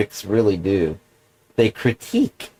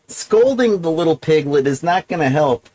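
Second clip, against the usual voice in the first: normal talking voice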